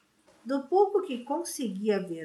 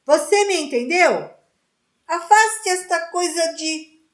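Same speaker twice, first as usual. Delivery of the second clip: louder, raised voice; angry shouting; 6 semitones higher, 9 dB louder than usual. A narrator's reading. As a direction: demand an answer, shouting. Tense standoff, both shouting.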